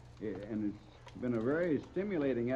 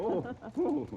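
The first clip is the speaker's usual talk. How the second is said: startled oh; 14 semitones higher than usual. Acted. Astonished at roller coaster idea.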